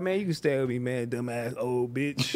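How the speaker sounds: low, mumbled daughter impression; a low, muttered dismissive daughter; lightly voiced daughter brushing him off; mocking daughter. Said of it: "She gonna be like".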